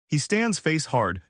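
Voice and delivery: usual speaking voice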